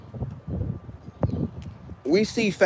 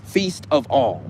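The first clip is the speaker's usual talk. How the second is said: word-by-word demo; slower than usual. Shown as a demonstration. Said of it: "I need y'all to say that".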